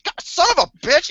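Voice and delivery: high pained mock curse; mock cursing. Getting shocked and cursing, in a high mock yelp. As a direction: yelp it like a static shock just hit. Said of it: "I'm like".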